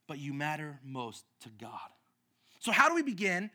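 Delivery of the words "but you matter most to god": soft reprise. Acted. Quiet reprise after awe.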